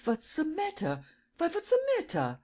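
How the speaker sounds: anxious, puzzled asking; alarmed old man. A narrator's reading. Steinweg seeing the widow sob, bewildered high German voice. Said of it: "asked Steinweg".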